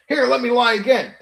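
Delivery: high, loud mock of a repeat liar; mocking imitation. Streamer mimicking people he unblocked who lie, mocking.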